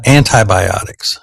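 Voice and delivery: ANTIBIOTICS said an-tie-by-OTICS; long I at the end of ANTI in ANTIBIOTICS; AN-tie pronunciation. Shown as a demonstration. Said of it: "I say anti instead of anti".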